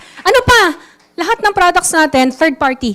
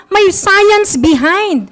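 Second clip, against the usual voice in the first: usual speaking voice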